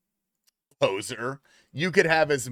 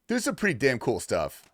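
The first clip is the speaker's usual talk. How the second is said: normal talking voice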